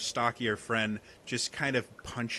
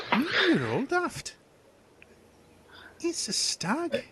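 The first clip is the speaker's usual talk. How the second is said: scornful hunter; 10 semitones higher, 3 dB louder than usual. Acted. GM voices hunter NPC, dismissive.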